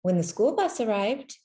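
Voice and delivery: usual speaking voice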